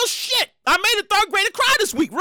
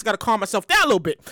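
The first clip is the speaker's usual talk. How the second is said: fast self-talk; 8 semitones lower, faster than usual. Real, not acted. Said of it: "I'm talking a little fast".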